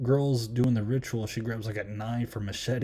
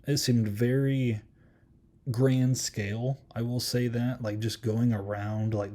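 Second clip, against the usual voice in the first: ordinary delivery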